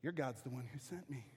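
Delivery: a hint of a sneering envoy; quiet sly provocation; lightly voiced enemy taunt.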